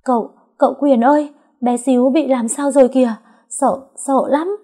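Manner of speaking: high, loud panicked call; high frightened shout for help; frightened cry. A narrator's reading. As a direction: call out in alarm, slowing at the end. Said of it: "giật giọng".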